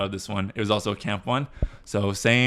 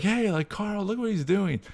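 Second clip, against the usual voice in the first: put-on much higher tattling kid voice; imitated kid; 11 semitones higher than usual. Camper pointing out Carl's antics, imitated kid voice. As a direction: call it out like a kid telling on someone.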